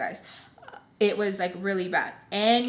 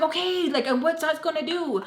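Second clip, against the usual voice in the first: exasperated retort; 8 semitones higher than usual. Retold or imitated. Arguing back with Baltimore.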